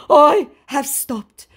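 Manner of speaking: high, loud, slow proclamation; mock declaration. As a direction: declare it hammily, drawn out. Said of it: "he declares".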